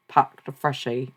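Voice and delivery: put-on rough, slow and loud Australian accent. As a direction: badly imitate an Australian saying it slowly. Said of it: "scooter slang from Australia".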